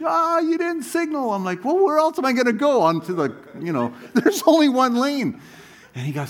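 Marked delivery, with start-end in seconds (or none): 0.0-1.3 angry trucker. Retold or imitated. Angry trucker voiced in story. 1.6-5.4 exasperated retort. Retold or imitated. Retold argument with trucker.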